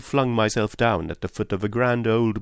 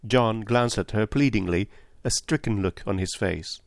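ordinary delivery, nothing marked

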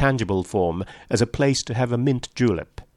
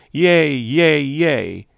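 far-off crowd cries of yay, higher and louder; distant cheering; 5 semitones higher, 5 dB louder, much slower than usual. A narrator's reading. Wedding guests downstairs cheer faintly from the ballroom. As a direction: call it like a cheer drifting through a window.

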